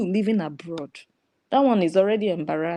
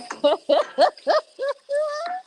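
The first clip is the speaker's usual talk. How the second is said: high burst of laughter. Real, not acted. Laughing at the pot seller, high burst of laughter.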